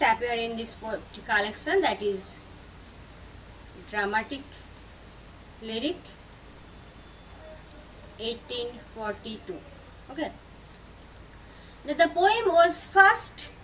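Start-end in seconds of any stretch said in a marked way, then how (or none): 3.9-9.6 low and soft, long gaps between the words; quiet, spaced-out note-giving; word-by-word dictation. Real, not acted. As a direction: dictate title and year piece by piece. Repeats title and year as if writing.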